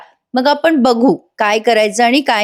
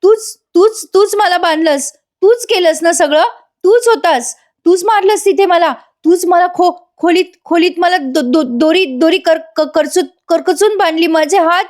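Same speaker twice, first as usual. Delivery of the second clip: high-pitched mock yell, stammering; hammed-up outcry tripping over the words; acted shouting; 8 semitones higher than usual. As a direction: accuse him in a worked-up, much higher voice. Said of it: "मोठ्याने सुमन ओरडते".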